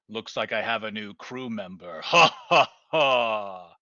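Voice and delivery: lightly voiced sinister knight with a laugh; a hint of a gloating villain cackle; death knight. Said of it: "Death Knight's voice go".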